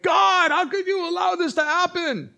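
very high, loud protest to God; acted shout of complaint; outraged cry. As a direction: wail it at heaven in mock outrage. Acted outburst blaming God.

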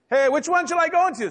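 high-pitched public call; mock yelling out. Preacher asking the coffee house, mock holler. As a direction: call it out high across the room. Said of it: "I just start yelling out".

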